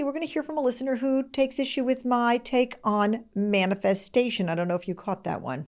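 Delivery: ordinary talk, nothing marked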